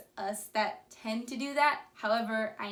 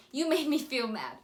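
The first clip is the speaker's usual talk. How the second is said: accusing blame; 3 semitones higher, 4 dB louder than usual. Shown as a demonstration.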